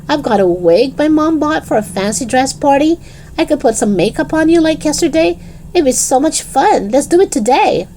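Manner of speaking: put-on high-pitched, excited girl coaxing a friend; eager Lisa. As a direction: talk a friend into it, pitched well up. Voices Lisa urging Dennis.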